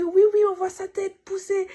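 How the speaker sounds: a hint of an excited midwife; lightly voiced midwife. Midwife to the mother, lightly voiced and high.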